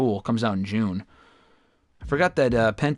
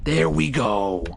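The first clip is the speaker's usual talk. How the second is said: relieved; much slower than usual. Real, not acted. Trade finally accepted.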